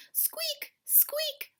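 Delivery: high squeaky creak of a lantern; lantern squeak. A narrator's reading.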